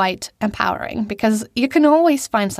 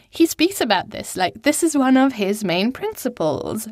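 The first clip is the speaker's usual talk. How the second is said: normal talking voice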